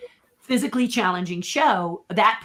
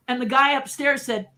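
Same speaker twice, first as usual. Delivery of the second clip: usual speaking voice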